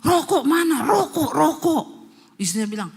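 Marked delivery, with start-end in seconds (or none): 0.0-1.8 loud, raised demand; possessed yelling. Retold or imitated. Possessed husband wakes demanding cigarettes, loud high yelling. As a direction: yell it out high and loud, dragging the repeats. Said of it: "teriak-teriak".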